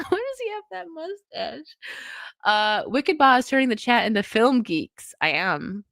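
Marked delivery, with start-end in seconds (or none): 0.1-1.8 soft, high little voice; high-pitched squeak. Real, not acted. Host baffled by a mustache, soft high squeak. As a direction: repeat it small and high, after a laugh.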